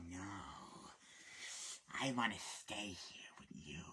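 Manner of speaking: whispered, leaning in close; menacing whisper; creepy whisper. Retold or imitated. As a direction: breathe it like a creep. Creepy Willem Dafoe character whispering.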